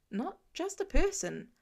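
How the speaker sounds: usual speaking voice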